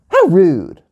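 sharp, high-pitched outburst; indignant yell. Pretend. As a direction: yell it like an offended kid. Repeats Gia's catchphrase after story.

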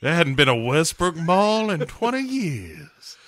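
hammed-up ominous retelling; Roger. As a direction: draw it out like a spooky reveal. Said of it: "And Roger's like".

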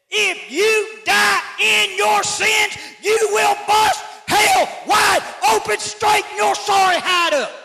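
slow fire-and-brimstone bellow; preacher's hellfire shout. Retold or imitated. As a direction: roar it from the pulpit like a fiery preacher. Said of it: "he says".

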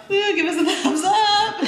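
high, loud, laughing whoop; excited cheer. Real, not acted. Vlogger asking viewers for thumbs up, high excited cheer.

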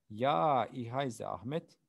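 a loud, bright German ja; emphatic yes. Shown as a demonstration. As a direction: call out the ja for the class. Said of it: "teacher models answer".

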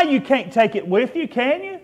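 ordinary delivery

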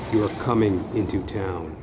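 plain reading voice

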